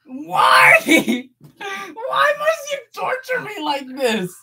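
hammed-up high crying wail; mock anguish. Streamer to a teasing viewer, mock wailing cry. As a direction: wail it like tortured.

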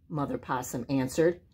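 plain reading voice

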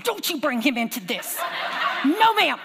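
high, loud mock scolding "No, ma'am"; mother scolding her praying toddler; mock stern scolding. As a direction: bark a mock telling-off through laughs. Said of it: "I said".

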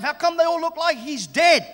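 ordinary talk, nothing marked

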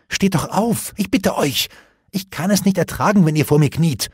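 high, urgent begging; upset youth imploring his father; distressed pleading. A narrator's reading. Distressed son begs kneeling father.

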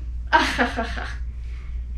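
breathy gloating ha-ha-ha; villain laugh. Acted. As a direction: cackle like a smug villain.

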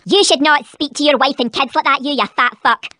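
deep, growling Scottish mum; gruff low mother voice. Acted.

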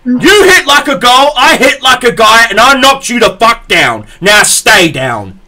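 tower over her and bellow; retold fighting shout that settles into a firm warning. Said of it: "She goes".

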